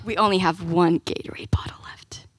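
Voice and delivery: low, near-whispered grim realization; hushed dread. Retold or imitated. Kids running out of Gatorade on a hike, hushed dread. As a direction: drop to a soft, ominous near-whisper. Retold dread at the hike's end.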